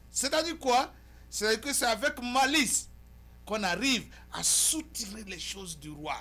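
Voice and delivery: loud, high, emphatic oratory; forceful editorial monologue. Acted. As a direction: declaim to the viewers in punched phrases.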